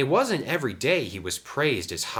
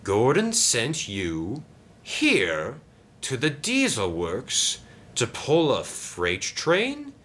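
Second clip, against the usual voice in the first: slow, drawn-out disbelieving question; incredulous Diesel; 5 semitones higher, slower than usual. Acted. Diesel checks what Gordon told Sam, incredulous. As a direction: spell it out slowly, higher, incredulous.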